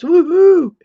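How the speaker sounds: ordinary talk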